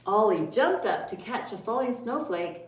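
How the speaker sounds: put-on lively storybook voice for kids; reading to children. Teacher reading a Christmas story to children, lively storybook voice. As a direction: read it bright and expressive. Teacher reads picture book.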